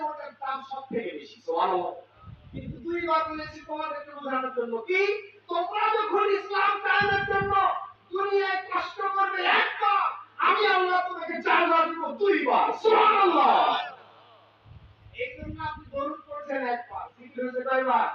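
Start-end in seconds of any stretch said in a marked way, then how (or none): 5.5-13.5 loud, high preacher's cry; full-force sermon shout; fiery preaching. Acted. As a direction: build it up to a roar for the crowd.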